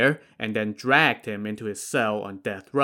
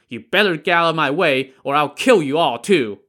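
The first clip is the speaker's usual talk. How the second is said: high, loud threatening yell; raving threat; 4 semitones higher, 6 dB louder than usual. Acted. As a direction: menace them like a madman. Madman threatens the prefect.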